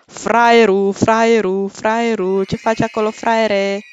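loud sing-out taunt, drawn over each repeat. Real, not acted. Player behind a closed door mocks Grimace, loud taunting call. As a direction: jeer at the pursuer left outside.

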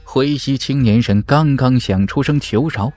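ordinary delivery

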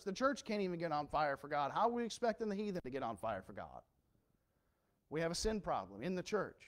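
ordinary talk

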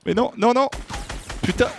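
high dismayed protest; panicking gamer. Real, not acted. Teammate just got killed.

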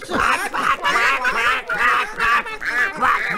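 rapid squawky quacks; duck quacking. Acted. Duck quacking happily at his nephews.

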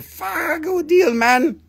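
very high, slow squeak; high squeaky voice. Pretend. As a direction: squeal out the great deal. Repeats the hype voice.

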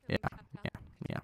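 breathy unvoiced whisper; whispered yeah. Real, not acted. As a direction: whisper it off-mic. Whispered yeahs.

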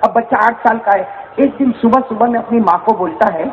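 ordinary talk, nothing marked

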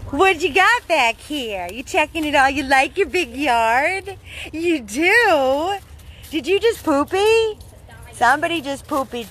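high, sing-song voice for the dog; pet talk. Real, not acted. Talking to the dog in yard.

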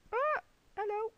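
high, loud yelp of surprise; yelp, then a high hello; startled yelp. Real, not acted. Startled by something in the game.

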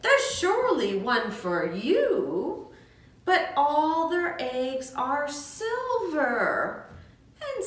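lively, sing-song storybook reading, voices high for the animals; reading to children. Acted. Teacher reads The Golden Egg aloud to young friends, sing-song. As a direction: read it like a bedtime picture book.

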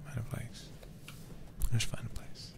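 soft unvoiced whisper. Real, not acted. Gamer whispering to the horse, soft whisper. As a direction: hush it under your breath.

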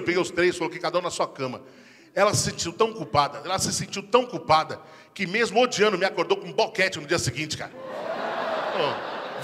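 normal talking voice

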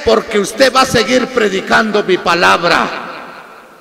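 retold divine command, preached with force; voice from heaven. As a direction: call it out slowly like a commission from above. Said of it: "escuché esa voz, me dijo".

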